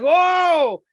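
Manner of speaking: high, loud burst of shock; a viewer watching the Allstate man die, loud and startled; startled whoa. Retold or imitated. Reacting to a character's death.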